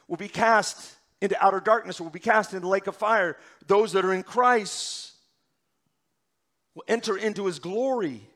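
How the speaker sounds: loud, driving preacher's cadence; full-force preaching. Acted. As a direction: hammer each phrase home to the congregation.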